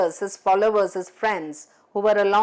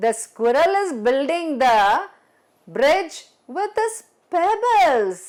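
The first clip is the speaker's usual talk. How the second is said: mock the idea to his friends; monkey sneering to his followers; sarcastic mockery; 6 semitones higher than usual. A narrator's reading. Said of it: "Now he was very sarcastic and he said".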